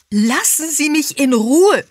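high, loud furious shout; explosive, high-pitched outburst; angry scream. A narrator's reading. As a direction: yell to be left alone. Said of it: "schrie sie und knallte".

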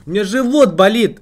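loud high whiny mimicry; mock her excuse, loud and high; mocking whiny mother. Streamer mocks mother's excuse.

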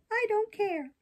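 high-pitched puppet defiance; defiant puppet. Acted. Puppet answering the fairy's warning, defiant.

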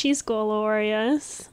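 usual speaking voice

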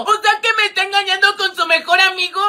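high, whiny near-crying voice; tearful dismay. Acted. Girlfriend told her boyfriend is cheating, tearful dismay. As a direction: wail it like a betrayed girlfriend.

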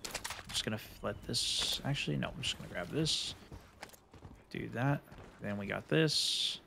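hushed whisper near enemies. Real, not acted. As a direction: keep it under your breath.

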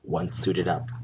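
plain reading voice

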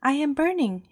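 lightly voiced burnt badger; badger's cry. A narrator's reading. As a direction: yelp as the scalded kettle-badger. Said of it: "it cried".

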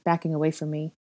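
plain reading voice

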